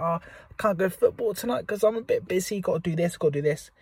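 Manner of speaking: imitating someone too busy for football; mock busy excuse. Mocking a busy entrepreneur skipping football. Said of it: "sometimes you're like".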